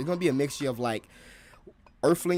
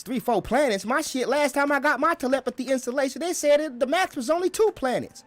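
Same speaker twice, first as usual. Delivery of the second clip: higher and louder, disbelieving; astonished future guy; 11 semitones higher, 3 dB louder than usual. Acted. Future guy comparing telepathy range with a friend, higher, astonished. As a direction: react like your plan got beat.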